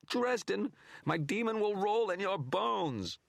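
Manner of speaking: very high, loud, slow shrieking curse; the image, dissolving, screams at Dresden; raging threat. Acted. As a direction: scream it as you are torn apart.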